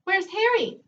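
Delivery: high squawky parrot call. Acted. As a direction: squawk it out like a talking bird. Parrot speaks in story.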